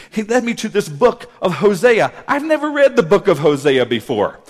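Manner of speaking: loud, insistent retelling of a testimony; impassioned preaching. Retold or imitated. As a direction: preach the husband's words with force.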